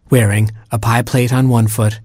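plain reading voice

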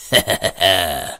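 bleating staccato goat cackle; evil goat laugh. Acted. Goat laughs after its threat.

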